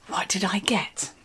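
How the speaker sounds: a soft whispered ASMR aside; mock ASMR whisper. Vlogger jokingly turning ASMR while unboxing, whispering. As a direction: breathe it like a whispering ASMR host. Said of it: "I'm an A S M R channel".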